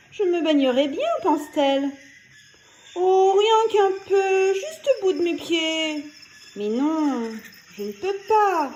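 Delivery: lightly voiced girl talking to herself; a hint of a child sighing at the heat; little girl. A narrator's reading. Little girl voiced, hot day.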